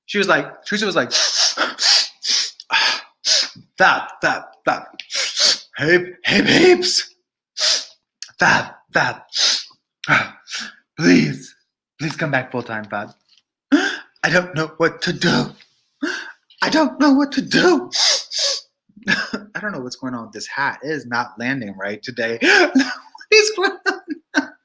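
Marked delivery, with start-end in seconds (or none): 1.1-17.9 breathy, begging voice jumping way up on babes; pleading Teresa reenactment. Acted. A streamer acting out Teresa begging Mister Fab, pleading. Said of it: "I wanna act like Teresa real quick". 22.4-24.5 shoots way up, squealing; squeaky exasperation. Real, not acted. A streamer fighting her hat on camera, squealing. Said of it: "fussing at her own hat".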